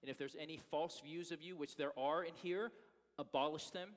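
soft, earnest prayer voice; pastoral prayer. Acted. Pastor praying before the sermon, soft earnest prayer. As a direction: pray sincerely for the congregation.

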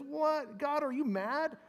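high, bewildered plea to God; anguished questioning. Acted. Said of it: "but he's questioning".

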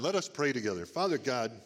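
ordinary delivery

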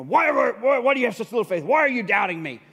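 loud, high angry scolding; acted exasperated telling-off; mad. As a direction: berate him as an accusation. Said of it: "Jesus is mad".

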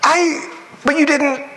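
high-pitched, sputtering disbelief; stunned disbelief. Retold or imitated. Regular believer baffled by evangelist's prayer: high, stunned sputter. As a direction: squeak out your confusion, half laughing at yourself.

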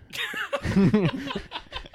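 a sudden high burst of laughter. Real, not acted. Friend cracking up at raw pig advice, high laugh.

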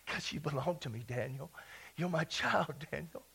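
very soft, low, intimate voice; tender fatherly reply. Acted. God telling Daniel he belongs, tender and hushed. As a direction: speak softly and low, reassuring a child.

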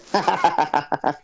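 high gleeful gremlin cackle; squeaky gleeful gremlin giggle. Pretend. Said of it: "Is that your gremlin voice".

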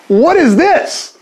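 high, drawn-out incredulous outburst; mock disbelief. Students reacting to the dancing ban, hammed-up disbelief. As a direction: stretch it out high, as if appalled.

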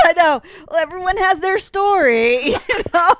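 high, loud laugh-crying; real laughing fit with tears. Real, not acted. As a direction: laugh so hard it turns to tears.

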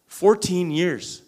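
"Fourteen years" drawn out slow and loud; stressed slowly. Real, not acted. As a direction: lean hard on "Fourteen years".

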